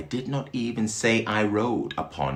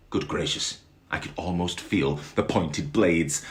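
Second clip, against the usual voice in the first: plain reading voice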